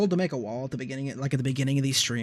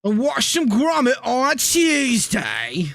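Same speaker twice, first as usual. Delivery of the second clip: slow, high, hammed-up British; British accent; 8 semitones higher, 3 dB louder, much slower than usual. Gamer answering speak English, in a slow hammed-up British accent. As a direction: drawl it like a cartoon Englishman. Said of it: "Speak English, man".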